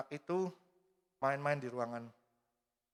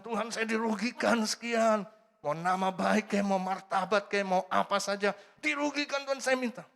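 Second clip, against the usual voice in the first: higher, slow complaining plea to God; aggrieved plea; 7 semitones higher, 5 dB louder than usual. Retold or imitated. Believer complaining to God about being wronged, aggrieved and slow. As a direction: lay out your grievance like a wronged petitioner.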